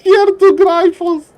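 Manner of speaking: amazed laughing caster; excited high call. Real, not acted. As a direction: squeal in disbelief.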